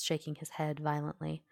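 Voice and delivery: ordinary delivery, nothing marked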